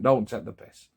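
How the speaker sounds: normal talking voice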